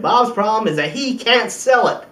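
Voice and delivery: ordinary talk, nothing marked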